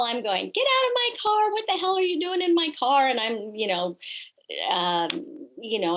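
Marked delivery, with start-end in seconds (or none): 0.5-3.1 high, loud retold shout at the intruder; frantic high-pitched retold yelling. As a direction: yell him out of the car, high and loud. Yelling at carjacker in story.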